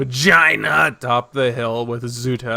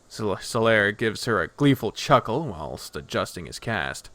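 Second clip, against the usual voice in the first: plain reading voice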